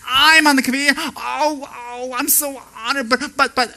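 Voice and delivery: high, gushing mock modesty; fake humility. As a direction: gush with pretend honor. Fake humble new member.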